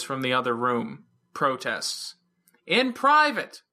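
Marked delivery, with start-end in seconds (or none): 2.7-3.6 short loud high call. A narrator's reading. Verona calls a loud teasing condition to the room. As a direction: toss it out loudly over the noise. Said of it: "Verona called out".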